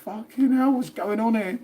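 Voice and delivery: high-pitched mock drunk woman; teasing a friend with a high mock-drunk voice; mock Martine. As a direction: squeal it like a tipsy friend. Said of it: "Be like".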